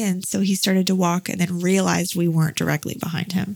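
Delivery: normal talking voice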